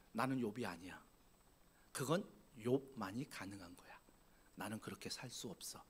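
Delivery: soft, whispered self-excuse; hushed resignation. Retold or imitated. Pastor voicing a discouraged churchgoer, hushed whisper. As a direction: murmur it like a defeated believer. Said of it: "어떻게 한 주간을 살아가시냐면".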